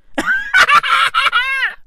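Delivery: high, ringing burst of laughter; loud high laugh. Real, not acted. Ranter laughing at his metaverse jab, loud high cackle.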